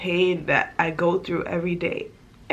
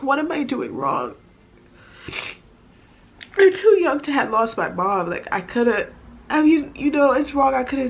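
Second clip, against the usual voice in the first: crying hard, voice breaking and straining; snotty crying; 5 semitones higher than usual. Real, not acted. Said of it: "I am sorry for the ugly crying".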